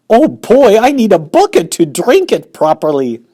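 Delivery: loud, high, gloating glee; greedy boy. Acted. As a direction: drool over the chocolate out loud. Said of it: "said Augustus".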